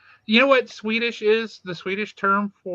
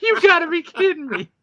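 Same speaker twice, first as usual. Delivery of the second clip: disbelief; 11 semitones higher, 5 dB louder than usual. Retold or imitated. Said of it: "I'm like".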